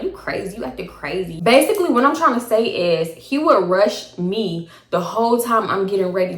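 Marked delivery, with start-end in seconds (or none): 0.0-1.4 quiet mock of him.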